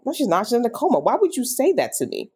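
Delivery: high-pitched, indignant protest; upset denial. Retold or imitated. Daughter refusing dad's news that her mom died, upset denial. Retold disbelief at news of death.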